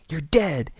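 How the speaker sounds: put-on loud, high child's taunt; boy crying out. A narrator's reading. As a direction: cry it out like a boy playing gunfighter. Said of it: "he cried".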